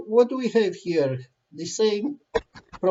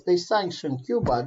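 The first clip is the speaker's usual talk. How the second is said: normal talking voice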